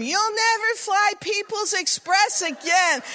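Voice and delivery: high-pitched mock yell; imitated yelled threat; yelling stewardess. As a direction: holler it as an outraged stewardess. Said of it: "she's yelling at them".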